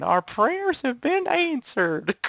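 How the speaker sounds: high, slow, overjoyed mom; imitating mom. Retelling the prank, imitating his mom overjoyed. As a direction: gush like a grateful mother. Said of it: "and his mom saying".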